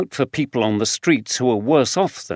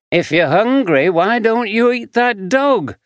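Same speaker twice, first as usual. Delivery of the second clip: jeering taunt; 9 semitones higher than usual. Acted. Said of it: "called another".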